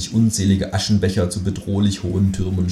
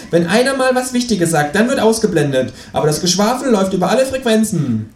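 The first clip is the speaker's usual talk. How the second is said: high, indignant kangaroo voice; grumbling kangaroo; 12 semitones higher than usual. Acted. Kangaroo complaining to the audience, high and grumpy. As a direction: rant as the kangaroo, pitched high.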